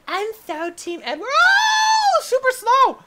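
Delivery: very high squeal building to a loud shriek. Pretend. Player joking about Team Edward as he slides, high squeal. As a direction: start as a gushing fan, end shrieking. Mock fan squeal, then alarm.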